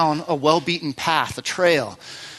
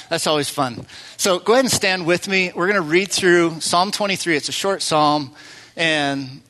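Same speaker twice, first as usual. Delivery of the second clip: normal talking voice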